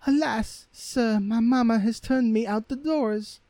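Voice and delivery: very high, timid maiden; young girl's voice. Acted. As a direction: answer the prince like a frightened girl. Girl answers the prince.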